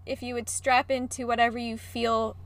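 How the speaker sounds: ordinary talk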